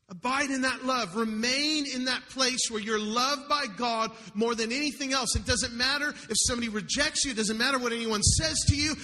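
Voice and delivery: rising sermon fervor with music under it; fervent preaching. Acted. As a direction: preach it out over the band.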